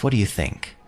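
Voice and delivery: ordinary talk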